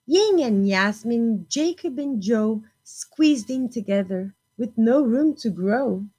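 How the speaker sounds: lively storybook reading; picture-book voice for a class; reading to children. Acted. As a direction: read it bright and expressive for little ones. Teacher reads picture book aloud.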